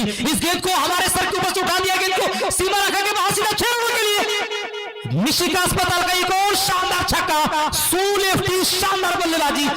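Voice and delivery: high, fast, thrilled commentary; excited six call. Real, not acted. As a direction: shout the six with joy. Commentator calls a big six.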